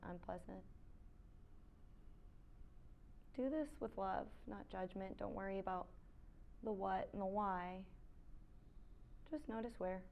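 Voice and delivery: soft, unhurried yoga-teacher voice with long pauses; quiet meditation cues, spaced out; guided meditation. Real, not acted. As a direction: guide them gently into stillness.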